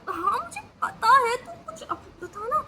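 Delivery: high, slow, tearful plea; begging her friend to suggest something, crying. Retold or imitated. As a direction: sob out the begging. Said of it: "तो मैं कहा".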